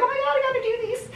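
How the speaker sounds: high, rushed squeal of dismay; comically frantic, squeaky and fast; mock panic. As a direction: imitate yourself fretting in a high, hurried voice. Quotes own panicked reaction, high and fast.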